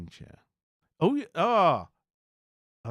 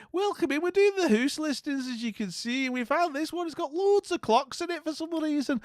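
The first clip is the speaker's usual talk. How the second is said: much higher, chirpy Scottish auntie voice; mock Scottish welcome, pitched way up; Scottish accent; 10 semitones higher, 5 dB louder than usual. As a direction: gush over Becca like a Scottish auntie. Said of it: "doing the accent".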